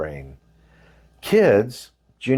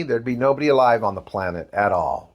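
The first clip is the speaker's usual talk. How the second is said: usual speaking voice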